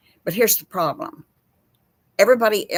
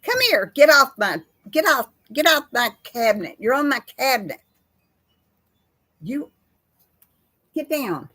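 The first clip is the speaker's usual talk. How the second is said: louder, higher call to get down; scolding a pet; 5 semitones higher, 4 dB louder than usual. Real, not acted. Owner scolding her cat off the cabinet, higher and louder.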